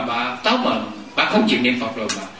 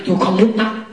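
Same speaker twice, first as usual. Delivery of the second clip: refusing patient; 7 semitones higher, 4 dB louder than usual. Retold or imitated. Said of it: "Người ta nói".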